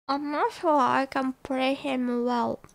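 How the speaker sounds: normal talking voice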